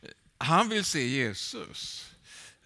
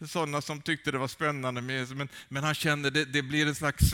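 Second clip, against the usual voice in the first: normal talking voice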